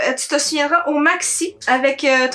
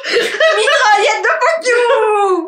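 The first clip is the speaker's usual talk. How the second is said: laughing; 8 semitones higher, 4 dB louder, much slower than usual. Real, not acted. Laughing joke.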